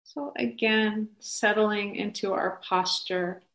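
slow, soft meditation voice; guided meditation. Acted. Teacher guiding a group into upright, settled posture, slow guided meditation. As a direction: settle them gently, with long pauses.

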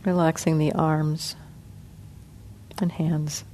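soft, unhurried meditation guidance with long pauses; guided meditation. Acted. Teacher guiding the sitting group through body relaxation, soft and calm. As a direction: ease the group gently through each part of the body.

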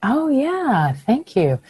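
normal talking voice

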